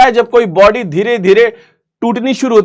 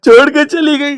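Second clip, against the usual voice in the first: a whiny fake sob over a breakup; half-hearted blubbering friend, voice cracking up high; hammed-up heartbroken wail; mock crying friend; 5 semitones higher than usual. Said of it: "कोई दोस्त बोलेगा".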